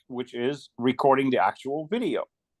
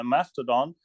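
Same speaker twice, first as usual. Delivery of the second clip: ordinary talk, nothing marked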